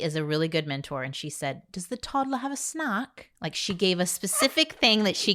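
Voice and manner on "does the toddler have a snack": put-on British accent. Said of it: "she had a British accent".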